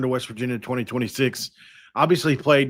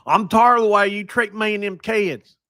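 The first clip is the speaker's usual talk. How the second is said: high, louder mocking voice with a twang of "them kids"; hammed-up impression of the ex; 9 semitones higher, 5 dB louder than usual. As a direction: exaggerate her complaint, pitched up. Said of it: "That's my impression of her".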